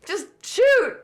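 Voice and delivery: imitating an excited shout, high and loud; high, loud mock excitement; excited quote. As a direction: act out his thrilled yell. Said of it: "Dylan Al was so excited".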